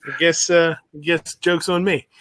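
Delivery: ordinary delivery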